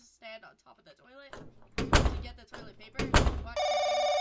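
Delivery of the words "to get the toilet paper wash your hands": incredulous shout. Acted. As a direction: yell it out in disbelief. Shouted mock disbelief.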